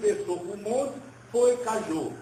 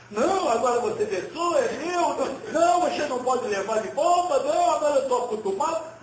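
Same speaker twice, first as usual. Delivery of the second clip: put-on higher, louder, playful refusal to hand the child back; hammed-up monk clinging to the child, no, no; mock-indignant monk, higher and louder, speeding up at the end; protesting monk; 7 semitones higher, 5 dB louder than usual.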